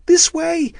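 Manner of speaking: high, loud screamed call; panicked shout for direction. A narrator's reading. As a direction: scream it to the running group. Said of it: "Amanda screamed".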